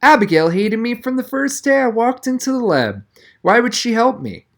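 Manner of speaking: high, incredulous protest; stunned disbelief. A narrator's reading. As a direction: cannot believe what he hears. Donovan in disbelief, eyes bulged.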